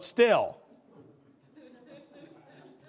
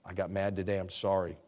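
humble apology; 6 semitones lower, 4 dB quieter than usual. Shown as a demonstration.

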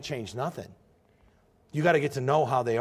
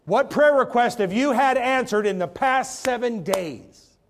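loud, high, slowed challenge to the congregation; preaching shout; 9 semitones higher, 8 dB louder, slower than usual. Real, not acted. Preacher demanding answers from his congregation, loud and slow. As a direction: lean in hard on every word.

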